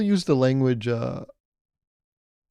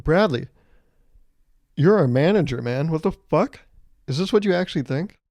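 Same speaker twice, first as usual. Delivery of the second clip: louder, higher outraged outburst at the boss; exasperated yell; 4 semitones higher, 4 dB louder than usual. Retold or imitated. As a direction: blow up at your manager. Yelling at the manager Bradley.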